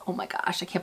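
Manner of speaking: hushed, whispered disbelief; whispered aside. Real, not acted. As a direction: whisper it as if bracing to confess. Bracing for a surprising confession.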